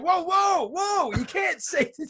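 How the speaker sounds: high, startled stop-it cry; mock-panicked commentators cutting him off, pitched high; alarmed whoa. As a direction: rush in to stop him on air. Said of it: "the club tells him".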